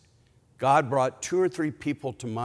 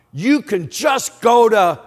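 angry yell, higher and much louder; real loud yell; 9 semitones higher, 10 dB louder, slower than usual. Retold or imitated. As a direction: holler it out like a ticked-off mechanic. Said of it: "he yelled real loud".

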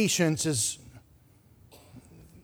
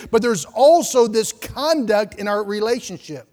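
louder, higher preaching push; fervent preaching; 5 semitones higher, 6 dB louder than usual. Acted. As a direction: preach it out with fire to the congregation. Preaching at full force to congregation.

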